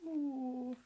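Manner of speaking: lightly voiced toy's ooh; puppet coo. Rosie Primrose puppet answers.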